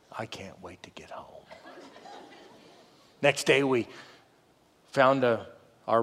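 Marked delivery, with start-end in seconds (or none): 0.1-1.4 very low, hushed murmur to oneself; retold private longing, barely voiced; weary whisper. Worn-out dad lying in the tent, weary whisper.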